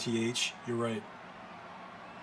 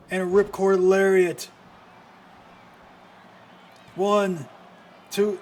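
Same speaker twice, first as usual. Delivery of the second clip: loud, high, rising hype; real hype on the comeback; excited shout; 4 semitones higher, 6 dB louder than usual. Real, not acted. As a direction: build the call with each move. Comeback sequence called live.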